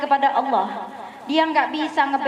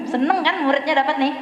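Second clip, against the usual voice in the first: ordinary delivery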